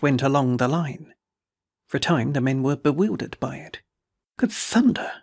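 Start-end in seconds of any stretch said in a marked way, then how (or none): 4.4-5.1 sharply higher burst of disbelief; startled exclamation. A narrator's reading. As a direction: blurt it out, much higher, caught off guard. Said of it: "they ejaculated".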